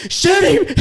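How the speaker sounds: high, loud command; urgent shout. Retold or imitated. Urging the shooters, urgent shout. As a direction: order them to fire. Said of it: "I'm like".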